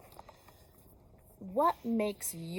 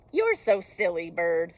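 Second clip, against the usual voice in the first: loud teasing; 3 semitones lower, 8 dB louder than usual. Acted. Animals laughing at Bird.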